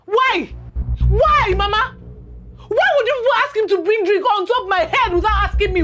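indignant protest. Acted. Daughter confronts mother over arranged marriage.

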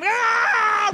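sudden high yelp as the dog lunges; dog's startled bark-shout. Retold or imitated. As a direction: shriek it out high and loud like the dog going for a stranger.

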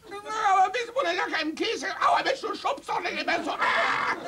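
put-on very high, squealing little boy; high child's voice whining and yelping. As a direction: squeal like a pushed child, close to tears.

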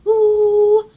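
high loud ooh; mock attack cry. Imitating her friend hitting her, high loud ooh. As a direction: yelp it like a fighter. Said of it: "starts hitting me like".

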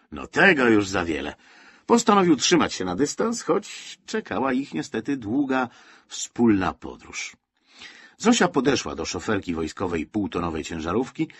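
ordinary delivery

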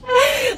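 high-pitched laugh. Real, not acted. Reacts to challenge.

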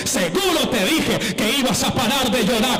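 loud, rising sermon climax; preaching at full force. Acted. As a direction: build the church up to a shout. (predicación a todo pulmón, cada vez más alta)

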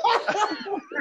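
high laugh that bursts out; quick rising laugh; bright burst of laughter. Real, not acted. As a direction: laugh at the mix-up. Laughing at name mix-up.

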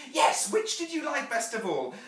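put-on high, loud little-girl question; eager child. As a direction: ask excitedly like a child. Child Jane questions her mother.

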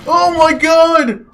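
high, loud cries of alarm; panicked shouting. Real, not acted. Player stuck in chest as creeper comes, panicked shouts. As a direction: shout frantically to escape.

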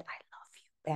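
soft, unvoiced whisper of love; whispering her mother's first "I love you". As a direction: breathe it as a secret whisper. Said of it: "she said".

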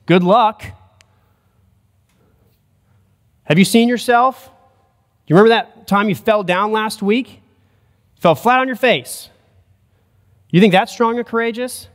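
mock them for falling flat; mocking taunt.

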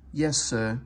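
usual speaking voice

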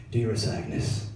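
low, slow, solemn letter voice; hammed-up solemn soldier writing home; old-timey soldier letter. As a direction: read it like a grave wartime love letter. Grandpa's wartime love letter voiced.

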